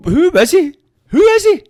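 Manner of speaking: loud, very high-pitched disbelief; incredulous outburst. Real, not acted. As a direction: demand it at the top of your range, baffled. Ranting about an obscure boxing personality.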